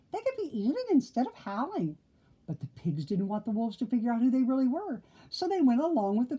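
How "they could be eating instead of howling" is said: high, puzzled piggy exclaim. Acted. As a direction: squeak it out, baffled. Pig voice continues.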